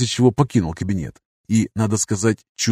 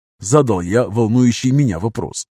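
ordinary delivery, nothing marked

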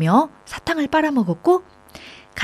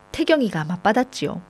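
ordinary delivery

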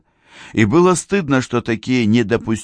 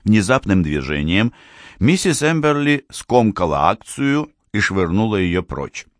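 ordinary delivery, nothing marked

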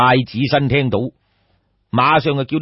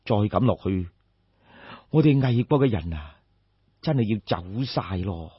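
softer, fading worried prince; a prince lamenting his country, trailing off; 憂慮嘆息; worried sigh; 5 semitones lower, 6 dB quieter than usual. A narrator's reading. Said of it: "好憂慮咁嘆一啖氣".

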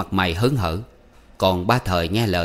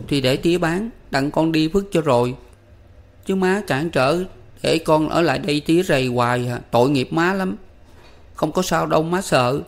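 put-on boy comforting mother; 9 semitones higher than usual. A narrator's reading. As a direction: console her bravely like a little boy. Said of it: "lấy vạt áo lau nước mắt".

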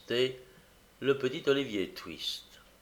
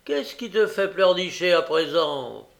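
moquerie méprisante; 5 semitones higher, 6 dB louder than usual. A narrator's reading. Noé se moque des larmes.

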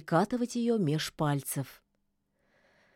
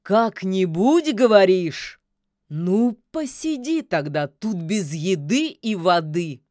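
menacing echo; 7 dB louder than usual. Acted. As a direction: throw the word back menacingly. Koschei mockingly echoes, threatens her.

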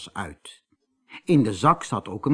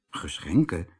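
surprised; 8 semitones lower, 4 dB quieter than usual. A narrator's reading. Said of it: "vroeg Peter verbaasd".

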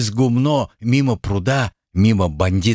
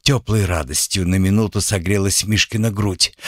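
usual speaking voice

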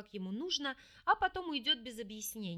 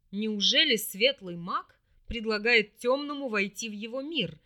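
louder, haughty demon sneer; 6 dB louder than usual. A narrator's reading. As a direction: ask it with lofty disdain. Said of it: "надменно спросил демон".

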